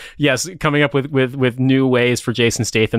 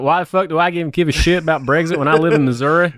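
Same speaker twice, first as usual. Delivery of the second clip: angry Missouri yokel; 6 semitones higher than usual. Pretend. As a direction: play a hot-headed Missouri local. Mocks cohost as angry Missourian.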